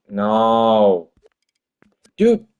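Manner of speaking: loud, sharp cry of frustration; frustrated yell. Real, not acted. A game dev yelling at his buggy game, exasperated. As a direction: yell it at the screen. Yells as bug reappears.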